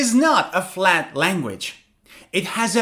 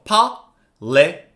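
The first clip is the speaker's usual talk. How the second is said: long pauses between the syllables of "politician". Shown as a demonstration. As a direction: say "politician" as four separate beats. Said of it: "also has four syllables".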